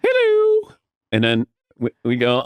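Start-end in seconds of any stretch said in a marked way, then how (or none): 0.0-0.7 high falsetto hello, sung out; Bob's squeaky hello. Retold or imitated. Boss passing typists, greeting in high falsetto. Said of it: "And he'd go".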